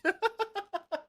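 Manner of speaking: high, loud peal of laughter. Real, not acted. Streamer cracking up at chat over a band, laughing. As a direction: burst out laughing.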